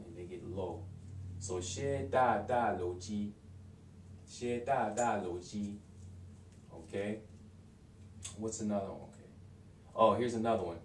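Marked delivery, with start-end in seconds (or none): 1.6-5.8 "xie da da lo ji" slowly, each syllable clear; careful, drawn-out "xie da da lo ji"; slow contracted form. Shown as a demonstration. Teacher repeats the contracted form slowly for learners. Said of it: "drop the N I and add L".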